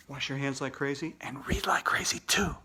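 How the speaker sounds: whispered goodbye advice. Real, not acted. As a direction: share it like a secret. Host signing off.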